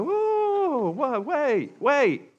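high, startled whoa, wait; stunned objection to a shocking turn; incredulous protest. Acted. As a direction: throw up your hands in disbelief. Incredulous listener questioning God's plan.

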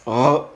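R rasped in the back of the throat; a throaty French R on its own; R gutural; guttural r. Shown as a demonstration. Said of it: "la R siempre es gutural".

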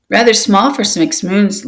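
usual speaking voice

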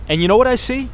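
high, bright teasing question; excited tease. Real, not acted. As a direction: build suspense, voice leaping up. Teasing big news to viewers.

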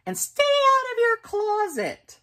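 very high, loud final command; mother snapping a last order at the door; parting order. Acted. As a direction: fling it back as she leaves. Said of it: "she added".

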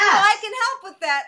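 eager matchmaker's bright offer; eager pitch. Pretend. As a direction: pounce on it brightly. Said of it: "I should say".

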